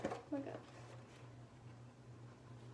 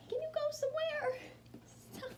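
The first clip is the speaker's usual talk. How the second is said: very high, loud coaxing of a pet; squeaky dog talk; 4 dB louder than usual. Real, not acted. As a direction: pitch it way up to excite the dog. Coaxing her dog, repeated higher.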